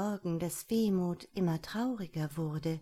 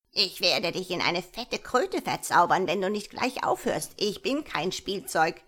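louder, swooping gnome voice, cross; very annoyed gnome; 5 dB louder than usual. Acted. Gnome warns the mocking sister, very annoyed. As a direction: threaten crossly as a little wizard. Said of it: "sagte der Wicht sehr ärgerlich".

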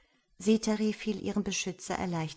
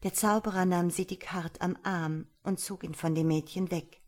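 ordinary delivery, nothing marked

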